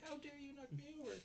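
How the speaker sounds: very high, fast prissy squeal with "How" on top; mock haughty girl. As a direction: parody her as a spoiled princess. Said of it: "she's like".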